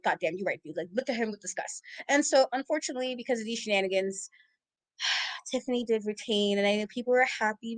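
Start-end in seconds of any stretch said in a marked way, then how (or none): 0.0-1.8 grudging mutter. Retold or imitated.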